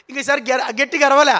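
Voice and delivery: ordinary talk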